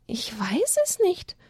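slow unsure whisper. A narrator's reading. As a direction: whisper it slowly, hiding the fear. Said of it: "antwortete Elia".

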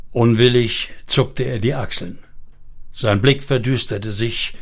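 plain reading voice

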